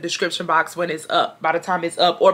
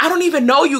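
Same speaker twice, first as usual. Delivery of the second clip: mild mock yell; 6 semitones higher, faster than usual. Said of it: "that's what she just kept yelling".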